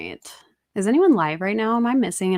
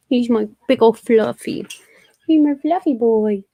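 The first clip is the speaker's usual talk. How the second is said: doting pet talk; 3 semitones higher, 6 dB louder than usual. Real, not acted. Owner showing off big old fluffy cat, doting baby talk. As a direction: fuss over a beloved pet, pitch climbing.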